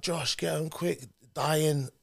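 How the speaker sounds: distressed dad. Retold or imitated.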